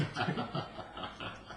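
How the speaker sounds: soft unvoiced chuckle; breathy laugh. Real, not acted. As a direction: laugh quietly at your own joke. Laugh after punchline.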